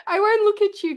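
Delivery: high, laughing apology; giggling. Real, not acted. Can't stop giggling while her partner sings, high and laughing. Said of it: "I can't apparently take it serious".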